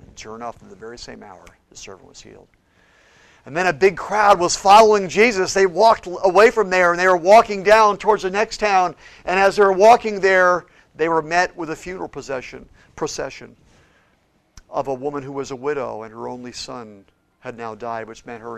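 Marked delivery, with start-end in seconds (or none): 3.4-12.7 louder, higher sermon drive; preaching. Acted. Pastor telling the crowd following Jesus, fervent preaching. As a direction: push the story forward from the pulpit.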